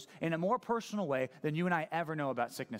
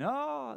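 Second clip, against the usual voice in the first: high, drawn-out mock wail; imitated wail; 11 semitones higher than usual. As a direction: wail an "oh" as if mourning. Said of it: "like a wailing".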